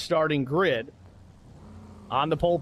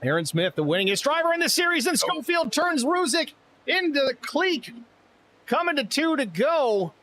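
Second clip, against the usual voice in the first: high-pitched, rising excitement call; excited race call; 11 semitones higher than usual. Acted. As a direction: shout the crash and finish as it happens. Caller reacts to a late crash.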